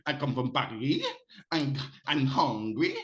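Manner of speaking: English in a hammed-up French accent; exaggerated French accent. Shown as a demonstration. Host shows guest how to fake French, exaggerated French accent. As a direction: exaggerate it like a mock French speaker. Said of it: "then you just exaggerate it".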